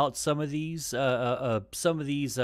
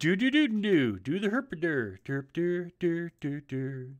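derpy voice; 4 semitones higher than usual. Pretend. Goofing on the verbal stumble.

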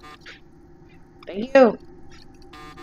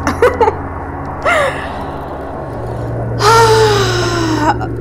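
crying laugh; 10 semitones higher than usual. Real, not acted. Laugh cry after failing.

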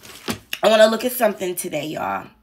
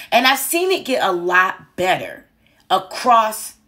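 ordinary talk

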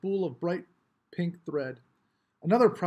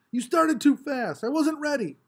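much higher, loud accusing whine; angry protest; 9 semitones higher, 5 dB louder than usual. A narrator's reading. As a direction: blame the starter. Mars protesting after losing.

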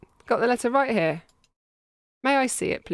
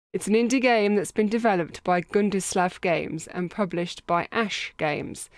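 normal talking voice

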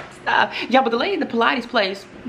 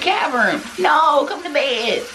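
high, drawn-out plea to a pet; retold call to a stubborn dog, pitched up and stretched; 6 semitones higher, much slower than usual. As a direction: coax the dog to bed in a high voice. Retold calling dog to bed.

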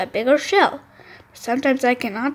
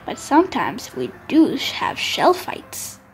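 plain reading voice

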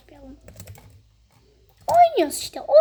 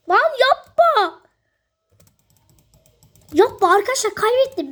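whiny plea; 6 semitones higher, 3 dB louder than usual. Real, not acted. Pleading as a match is lost.

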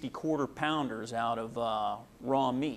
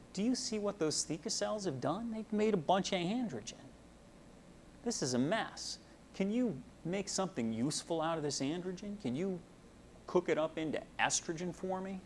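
higher, softer coaxing voice, lightly acted; voicing FSH; 5 semitones higher, 5 dB quieter than usual. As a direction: gently ask the cells a favour, pitched up. FSH voiced coaxing granulosa cells.

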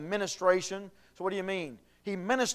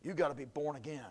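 ordinary talk

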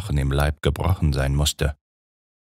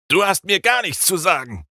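much higher furious outburst; angry cry. A narrator's reading. Dracula grabbing the brunette's curls, furious cry. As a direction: cut her down while grabbing her. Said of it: "rief er".

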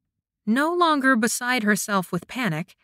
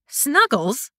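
indignant squawk; a flustered squawk; 5 semitones higher than usual. A narrator's reading. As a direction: yelp it back in disbelief. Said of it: "she squawked".

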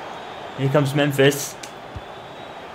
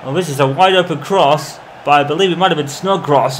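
usual speaking voice

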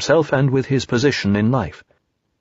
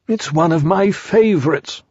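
Pooh voice; 6 semitones higher than usual. Acted.

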